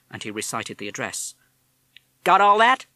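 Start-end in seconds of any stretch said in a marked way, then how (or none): 2.2-2.9 put-on higher, louder parrot with a tough American accent. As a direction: talk like a fast-talking American wise guy. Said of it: "You have an American accent".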